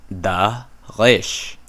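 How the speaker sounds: Da Rish with a throaty Hebrew r, stress on Rish and a falling end; careful Hebrew r. Shown as a demonstration. Teacher repeating the right form of dagesh. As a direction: say Da Rish slowly and clearly. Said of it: "It's da".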